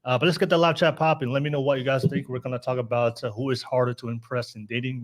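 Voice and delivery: ordinary talk, nothing marked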